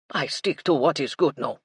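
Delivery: placated French chef; French accent. A narrator's reading. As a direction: agree with a French lilt. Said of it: "Michel's French accent".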